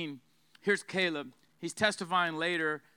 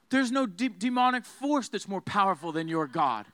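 usual speaking voice

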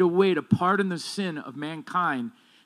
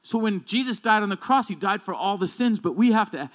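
louder, driving pulpit delivery; full-force preaching; 3 semitones higher, 4 dB louder than usual. Acted. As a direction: preach it hard to the congregation. Builds to a loud preaching climax.

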